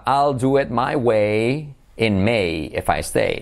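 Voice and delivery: very slow, lengthened vowels; slow model sentence. Shown as a demonstration. Teacher presenting the prepared phrase, very slowly. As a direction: draw out each phrase for learners.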